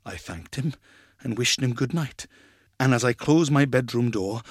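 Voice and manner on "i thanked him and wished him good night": ordinary delivery, nothing marked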